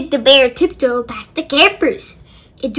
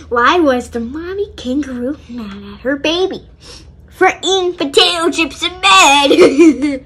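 usual speaking voice

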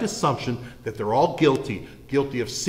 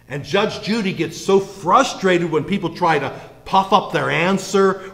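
usual speaking voice

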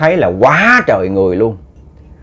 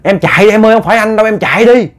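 high, louder urgent call; calling out urgently; 8 semitones higher, 3 dB louder, faster than usual. Retold or imitated. Husband's voice urges his wife to flee, urgent call. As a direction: warn her to run. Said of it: "cái tiếng kêu á".

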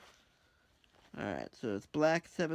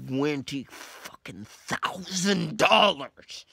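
louder, slowed, drawn-out frustration; frustrated slow outburst; 8 dB louder, much slower than usual. Real, not acted. Missing twenty thousand dollars, a slow frustrated outburst. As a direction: drag it out, furious at missing out.

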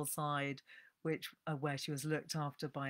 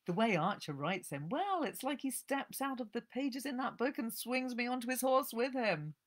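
much higher, dreamy gushing voice; gushing old Nancy; 9 semitones higher than usual. Acted. Old woman raving to the librarian about a book, dreamy. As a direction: melt over the hero.

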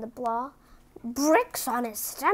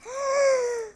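high startled gasp; mock gasp; 12 semitones higher, 4 dB louder than usual. Reader gasping at the next line, mock gasp. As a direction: gasp in surprise. Gasps at hard twister.

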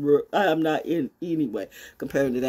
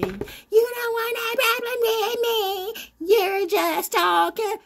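slow, much higher taunting voice; mock threat; slower than usual. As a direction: taunt them in a high sing-song. Threatening haters in put-on voice.

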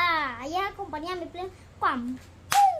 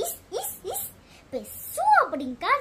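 imitated squeal; 4 semitones higher than usual.

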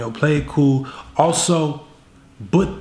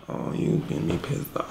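seething hiss; 9 dB quieter than usual. Acted. Acted girlfriend seething.

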